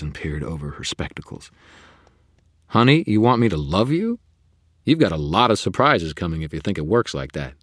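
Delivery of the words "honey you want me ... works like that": louder, higher wry retort; sassy Susan. A narrator's reading. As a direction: needle her with sarcasm. Susan voiced by narrator.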